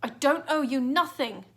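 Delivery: loud defiant shout; boy yelling at the killer; angry yell. A narrator's reading. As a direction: hurl it back in anger. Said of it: "he yelled at the killer".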